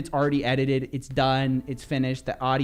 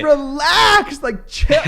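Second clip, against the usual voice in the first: loud retort; 10 semitones higher, 7 dB louder, much slower than usual. Retold or imitated. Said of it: "I go".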